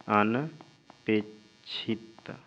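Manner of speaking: अनपेक्षित drawn out very slowly, syllable by syllable; slow dictation. Shown as a demonstration. Teacher writing अनपेक्षित on board, dictating slowly. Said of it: "अनपेक्षित हो गया एक".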